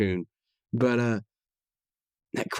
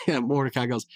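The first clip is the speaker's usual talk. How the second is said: ordinary delivery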